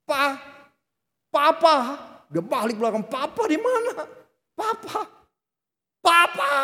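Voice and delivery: put-on high, loud panicked child calling; child screaming for papa. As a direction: cry out for a lost parent. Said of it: "dia mulai teriak".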